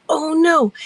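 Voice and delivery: high, loud "uh-oh" dismay; retold alarm. Storyteller recalls swiping into the wrong show, alarmed.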